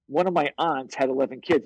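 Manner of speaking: usual speaking voice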